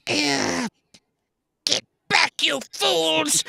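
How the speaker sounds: very high screechy villain voice; hammed-up, piercing evil commander; Cobra Commander. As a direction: screech orders like a cartoon villain. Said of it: "I went Cobra Commander".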